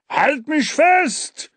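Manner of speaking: put-on high, drawn-out yell in a rural accent; country-accented yell. A narrator's reading. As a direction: holler for help like a country man. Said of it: "schrie Dampfi, und man hörte an seinem Akzent".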